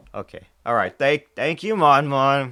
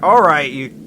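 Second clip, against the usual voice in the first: loud; 6 semitones higher, 14 dB louder than usual. Real, not acted. Bursting out at chat.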